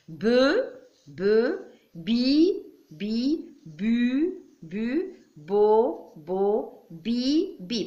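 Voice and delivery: "Be, be, bi" as separate syllables, vowels held and rising; syllable reading drill. Shown as a demonstration. Teacher modelling syllable reading for children, each one separate and lifted. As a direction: chant each of "bi, bu, bo" as one drawn-out blend. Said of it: "Vois comment je lis?".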